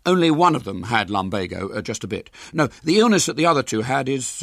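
stammering schoolboy bluff; flustered boy voice. Acted. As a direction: cover a mistake quickly. William bluffs.

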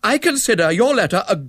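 high, loud, haughty rebuke; extremely haughty. A narrator's reading. Offended young woman rejects the Major's letter, extremely haughty. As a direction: put him down with lofty indignation. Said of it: "attractive but extremely haughty voice".

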